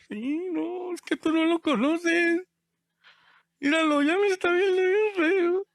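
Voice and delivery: acted whiny worry about an angry dad; hammed-up anxious kid, pitched high; worried child. Acted child fearing father's look.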